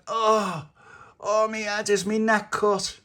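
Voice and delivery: high, slow acted whine; pained moaning. Tony hurt after being pulled free, pained moaning. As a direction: moan it as if injured.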